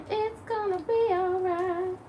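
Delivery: high, soft, slow reassurance; reassuring. Retold or imitated. Mom tells the dog it's all right, soft and slow. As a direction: comfort her like a baby. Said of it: "And we tell her".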